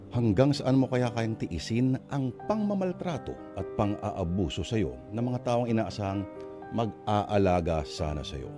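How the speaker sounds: ordinary delivery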